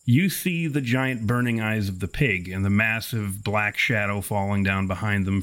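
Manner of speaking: ordinary delivery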